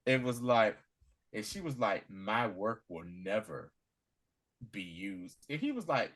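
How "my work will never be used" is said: solemn defiance. Retold or imitated. Retold defiant refusal at gunpoint.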